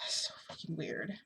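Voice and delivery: breathy whispered aside, half to herself. Real, not acted. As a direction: whisper it with a sigh. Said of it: "sighs, whispered aside".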